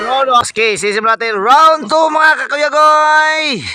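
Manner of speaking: usual speaking voice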